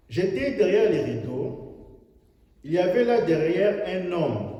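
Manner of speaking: loud, high fervent sermon delivery; full-force preaching. Acted. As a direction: preach it out to the hall. Preacher retells critic scene.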